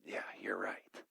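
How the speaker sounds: whispered, low, giving in; resigned whisper. Retold or imitated. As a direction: breathe it out, conceding. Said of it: "And I'm like".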